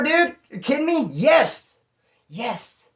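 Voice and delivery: high, loud thrilled disbelief; overjoyed. Real, not acted. Finding the weed in the bag, high thrilled yes. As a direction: exclaim like the best surprise ever.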